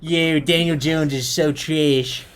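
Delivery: put-on slow, drawn-out Philly accent. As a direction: drag it out like a Philly fan. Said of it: "That's a pretty good Philly accent".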